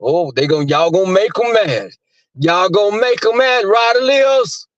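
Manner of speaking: high-pitched gleeful taunt to the chat; imitating Jay Wilson. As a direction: chant it like a hyped-up friend teasing. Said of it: "Jay Wilson start saying".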